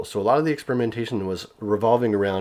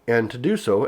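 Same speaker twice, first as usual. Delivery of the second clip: ordinary delivery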